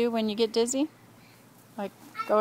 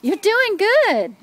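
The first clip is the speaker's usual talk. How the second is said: encouraging praise; 8 semitones higher, 5 dB louder, much slower than usual. Real, not acted. Grandma praising kids at play.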